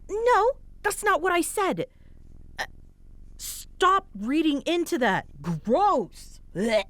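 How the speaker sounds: high-pitched, embarrassed protest; flustered denial. Acted. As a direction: squeak out the denial like you've been caught. Flustered denial that she's cute.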